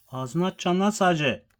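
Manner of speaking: loud, high scolding; stern shout. Real, not acted. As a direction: bark the order at the animal. Scolding the pet to eat.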